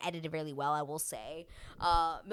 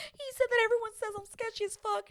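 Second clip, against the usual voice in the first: high, whiny imitation of tearful complaint; badly faked weepy voice, pitched way up; mock crying. Crying woman telling her partner what was said, mock weepy. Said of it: "she's crying".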